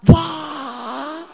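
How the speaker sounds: loud high cry of wonder; amazed wow. Shown as a demonstration. Preacher leading practice, loud amazed wow. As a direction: shout it mouth wide open. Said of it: "Have some practice".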